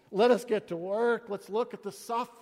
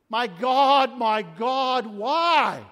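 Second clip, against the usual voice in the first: crying out loud in anguish; 6 dB louder, much slower than usual. Acted. Preacher voicing Jesus on the cross, loud anguished cry. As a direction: shout it like a man forsaken on the cross.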